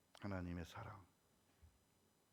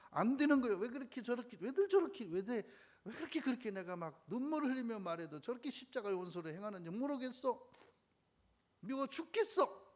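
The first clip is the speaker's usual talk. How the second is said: much higher and louder, frustrated wail; exasperated outcry; 11 semitones higher, 7 dB louder than usual. Retold or imitated. Preacher voicing Paul, exasperated at co-workers, high loud outcry. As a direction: cry out in exasperation at stubborn believers.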